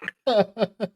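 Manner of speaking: short amused laugh. Real, not acted. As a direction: burst out laughing. GM laughs at reaction.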